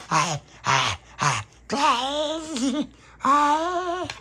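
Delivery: high, crying hey please; crying vampire calls. Retold or imitated. Tony coming to hunt, high crying calls. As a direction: wail and plead up high. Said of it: "Like that".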